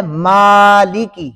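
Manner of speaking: مالکی with a long "maa"; مالکی said slowly and clearly for learners; slow model reading. Shown as a demonstration. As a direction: stretch the first vowel of مالکی. Teacher models next Quranic word.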